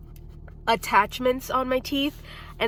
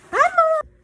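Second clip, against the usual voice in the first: very high cooing hello; high sing-song greeting; 7 dB louder than usual. Real, not acted. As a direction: coo it up high.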